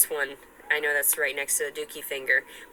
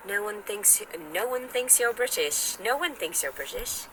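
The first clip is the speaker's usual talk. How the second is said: higher-pitched, badly faked British accent; pitched-up mock British; attempted British accent; 5 semitones higher than usual. Shown as a demonstration. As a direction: put on an amateur British accent. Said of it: "try to pull off one of those accents".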